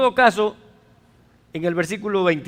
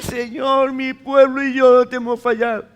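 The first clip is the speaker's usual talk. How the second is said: súplica llorosa; 4 semitones higher, 3 dB louder than usual. Retold or imitated. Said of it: "comienza a llorar y a decir".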